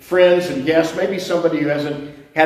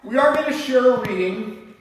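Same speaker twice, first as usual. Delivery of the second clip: preaching; 5 semitones higher than usual. Acted. Preaching, slow listing.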